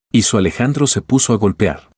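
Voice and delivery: usual speaking voice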